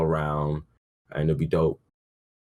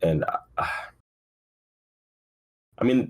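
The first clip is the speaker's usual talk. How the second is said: ordinary talk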